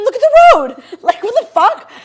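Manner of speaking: loud, high shout, breaking into a laugh; incredulous shout. Retold or imitated. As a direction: yell it at the driver in disbelief. Angry outburst retelling Uber driver.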